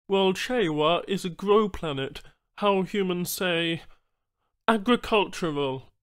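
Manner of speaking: high, odd-timbred alien voice; alien Banu trader. Acted.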